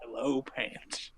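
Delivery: slow, very soft impression; creepy greeting. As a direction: draw it out in a hushed put-on voice. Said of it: "where he just greets the audience going".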